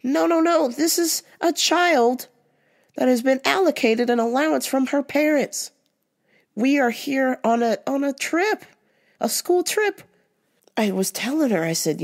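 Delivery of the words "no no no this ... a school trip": high, insistent teacher voice correcting a mistake; no no no, set her straight like a stern chaperone; firm band instructor. Retold or imitated. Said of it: "my band instructor came and was like".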